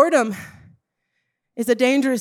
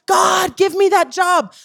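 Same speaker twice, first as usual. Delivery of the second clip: higher and louder, a whiny demand to God; mock demanding prayer; 6 semitones higher, 5 dB louder than usual. Preacher mocks a lazy believer's prayer, a whiny demand. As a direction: pray like you expect it handed to you.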